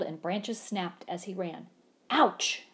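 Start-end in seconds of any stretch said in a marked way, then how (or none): 2.1-2.7 a loud high ouch; loud pained ouch; howled ouch. A narrator's reading. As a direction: yelp as he hits the tree. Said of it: "howled Mister Winky".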